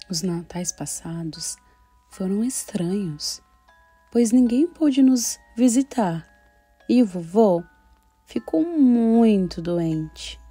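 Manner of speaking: put-on gentle storytelling for children; story narration. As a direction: read it like a child's diary. Reads girl's diary story.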